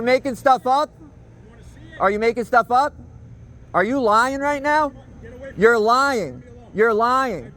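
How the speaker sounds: high accusing call; accusing shout. Real, not acted. Shouting at the neighbour that he is lying.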